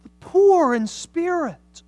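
high, loud, near tears on the dying; impassioned preaching. Acted. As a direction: plead it from the pulpit, voice cracking. Preaching at full force.

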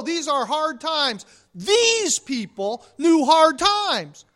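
loud, driving preacher's build; fiery sermon climax; preaching at full force. Acted. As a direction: hammer it home from the pulpit.

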